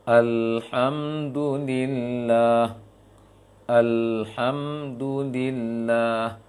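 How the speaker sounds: "Alhamdulillah" drawn out very slowly, lam flowing; recitation demo. Shown as a demonstration. Teacher reciting for students, very slow. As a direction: stretch "Alhamdulillah" long and even. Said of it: "Ketika lam sukun misalnya".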